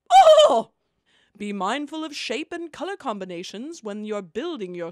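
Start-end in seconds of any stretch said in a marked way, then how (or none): 0.1-0.7 very high loud yelp; high shriek. Acted. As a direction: shriek out in alarm. Another cry.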